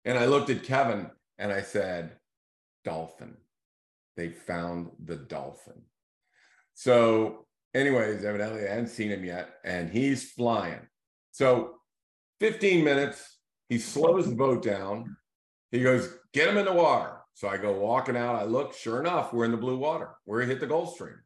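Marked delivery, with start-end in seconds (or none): 2.8-5.8 much softer and lower, hushed and secret; hushed aside. Retold or imitated. Secretly telling Kevin the news. 16.3-17.2 higher, louder mock captain's shout; barked order.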